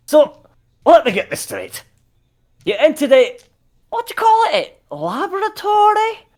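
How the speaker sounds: high, loud astonished voice; NPC voice. Acted. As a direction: squeak it out in disbelief. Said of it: "he's like".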